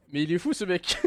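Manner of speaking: said through laughter; amused disbelief while laughing; giggling "il est fou". Real, not acted. Laughing at the game.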